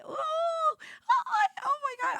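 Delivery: very high, overwhelmed squeal; real choked-up outburst; very emotional. Real, not acted. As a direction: let the voice shoot up as emotion hits. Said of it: "I'm gonna get very emotional".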